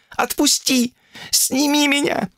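very high-pitched outcry; yelling to be released. Acted. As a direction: cry out to be let down, voice way up high. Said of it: "Она орала во весь голос". (отчаянно высокий крик)

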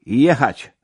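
loud, high command; called-out order. A narrator's reading. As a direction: call out to the driver. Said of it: "zawołałem do posztyliona".

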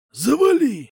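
loud, high angry bark; growled. Acted. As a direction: snap it like a growl. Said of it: "рыкнул дядя Слава".

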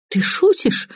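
stunned high-pitched question; you can't believe what you heard; startled disbelief, voice jumping up; taken aback. A narrator's reading. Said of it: "опешила Лизавета".

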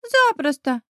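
chest-out boyish pride, gently played; proud boy. A narrator's reading. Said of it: "Гордо выпитив грудь". (гордо, по-мальчишески, повыше)

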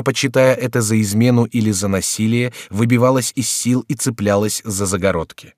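ordinary delivery

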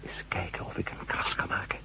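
soft tense whisper. Acted. Matt whispers to the doctor as he tries to scratch it, hushed whisper. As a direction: breathe the words out unvoiced.

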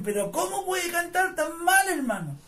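high-pitched, incredulous mockery, dropping on the last word; mock-appalled, pitched well up; mocking disbelief. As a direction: imitate someone ridiculing a terrible singer. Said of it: "se reían en mi cara y me decían".

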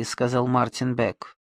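ordinary delivery

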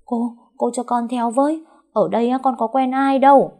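high-voiced worried maid, urgent; anxious pleading. A narrator's reading. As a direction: plead nervously to be taken along. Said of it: "Đào lo lắng hỏi dồn".

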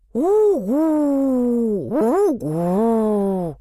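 hooting like an owl. A narrator's reading. Owls hooting.

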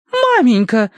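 high indignant exclaim; indignant cry. A narrator's reading. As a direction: cry out, offended. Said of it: "возмущённо воскликнула Тосси".

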